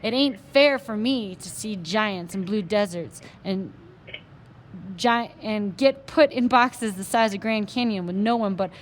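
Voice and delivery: loud, slow self-pitying Texas cowboy; a drunk cowboy ranting in Texas twang; Texas accent. Acted. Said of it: "in his strong Texas accent".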